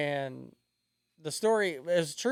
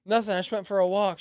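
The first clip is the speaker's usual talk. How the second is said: out of breath; 5 semitones higher, 5 dB louder than usual. Retold or imitated. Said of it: "Why are you out of breath?".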